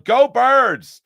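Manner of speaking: loud, high fan chant; yelling. Shown as a demonstration. Host yells at Phillies hats around Manhattan, fan yell. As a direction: yell it like a fan across the street. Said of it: "I'm yelling".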